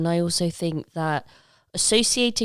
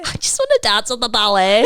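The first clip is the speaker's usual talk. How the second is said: badly faked Northern English accent, louder; terrible Northern accent; 3 semitones higher, 6 dB louder, faster than usual. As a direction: put on a loud, hammy Northern English accent. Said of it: "That was a terrible Northern accent".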